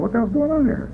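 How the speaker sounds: high, soft bewildered question; baffled inner voice. Retold or imitated. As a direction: wonder aloud in disbelief. Confused inner thought.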